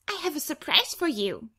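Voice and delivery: put-on a small child bursting to share a surprise; little girl's voice. Little sister to Angel, a lightly voiced giddy child.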